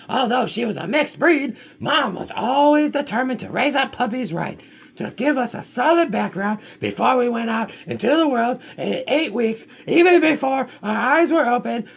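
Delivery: high, lively storybook read; reading to children. Acted. Parent reading the dog's story to kids, high and lively. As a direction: tell the puppy's tale brightly to the kids.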